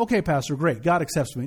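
low, casual acted listener brushing it off; offhand acceptance. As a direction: toss it off like a listener who misses the point. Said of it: "Some of us sit up in that moment and go".

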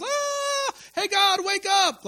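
high hammed-up yell to a sleeping god; mock frantic shout. Preacher mimicking Baal's priests, hammed-up high shout. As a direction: shout him awake, half mocking, slowly. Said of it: "And so they danced around".

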